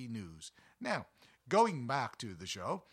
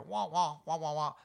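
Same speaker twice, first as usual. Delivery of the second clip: muffled trombone-like wah wah, higher and louder; Charlie Brown adult wah-wah; 3 semitones higher, 6 dB louder than usual. Retold or imitated. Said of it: "you'd hear".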